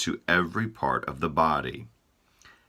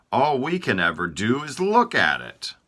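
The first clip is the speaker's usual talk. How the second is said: Eyes whining; 6 semitones higher, 4 dB louder than usual. Acted. Eyes speak.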